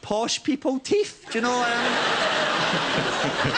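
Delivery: slow, toothy posh voice; mock the posh girl; posh accent. Posh imitation, teeth.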